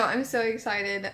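ordinary talk, nothing marked

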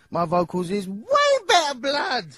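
high, loud cocky protest; indignant boast. Acted. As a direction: insist you sing better. Boasting about his singing.

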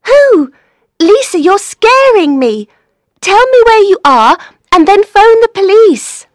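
loud, high, frightened insistence; urgent and alarmed; alarmed urging. Acted. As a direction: demand answers, scared for your friend. Scared friend urges caller to act.

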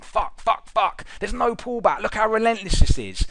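mock frustrated trader swearing at the chart; mock panic. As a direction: panicky trader's curses rising high and shrill. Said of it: "They're sitting there saying".

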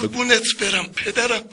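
قربونت برم پدرم squeaked up high; high-pitched devotion. Pretend. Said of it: "بگو". (قربونت برم پدرم را خیلی زیر و ملتمس بگو)

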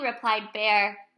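ordinary delivery, nothing marked

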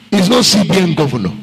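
ordinary talk, nothing marked

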